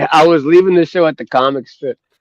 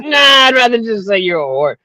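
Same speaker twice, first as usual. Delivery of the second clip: cheeky mom; 9 semitones higher than usual. Retold or imitated. Comic mom voice retort.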